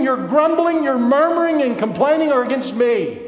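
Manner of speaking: loud, high preacher's cry; building sermon thunder; fiery preaching. Acted. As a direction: press the rebuke home from the pulpit. Preaching at full force.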